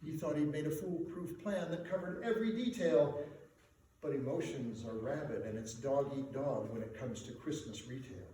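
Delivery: ordinary delivery, nothing marked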